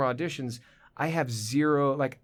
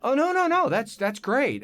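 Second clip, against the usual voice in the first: high, loud rush of approval; mock eager reassurance; 11 semitones higher, 4 dB louder than usual. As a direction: imitate a gushing casting director, pitched way up. Casting people eagerly reassuring him.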